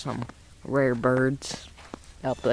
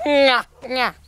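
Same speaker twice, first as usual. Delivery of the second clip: mating call; 8 semitones higher, 5 dB louder than usual. Shown as a demonstration. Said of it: "other one goes".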